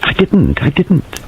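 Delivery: a touch of frightened helplessness; helpless. A narrator's reading. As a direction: plead your innocence, helplessly. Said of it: "She said helplessly".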